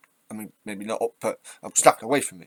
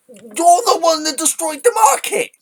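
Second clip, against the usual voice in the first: high, loud accusing shout; exasperated yell; 8 dB louder than usual. Real, not acted. Commentator blames politician over coalition of chaos, exasperated yell. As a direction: yell the accusation at full pitch.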